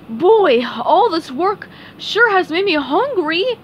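high, loud cartoon voice; Patrick. A narrator's reading. Patrick tells SpongeBob he is hungry, cartoon voice. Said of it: "said Patrick".

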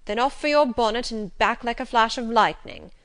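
loud, brisk command to hurry; brisk call to a child. A narrator's reading. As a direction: send her running for her bonnet. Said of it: "cried he to Adele".

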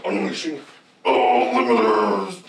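slow, low mechanical line; slow robot announcer. Acted. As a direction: drag it out like a machine. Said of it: "He goes like".

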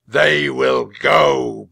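slow, thunderous decree; huge, drawn-out knightly shout; booming. A narrator's reading. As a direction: boom it out so the hall echoes. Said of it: "boomed the knight".

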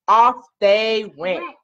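ordinary delivery, nothing marked

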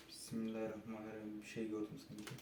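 murmured nervous prayer; barely audible, scared murmur; hushed. Real, not acted. Praying nervously in dark house.

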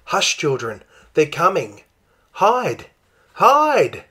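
panicked call to hide, building high; urgent warning. Acted. Mother urgently warning the children the men are coming, rising alarm.

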